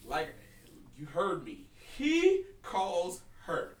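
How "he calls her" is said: very slow, deliberate, word by word; pointed emphasis. Real, not acted. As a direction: spell it out slowly so they get it. Spelling out a euphemism to listeners.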